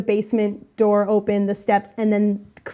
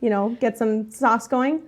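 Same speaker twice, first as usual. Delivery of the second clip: usual speaking voice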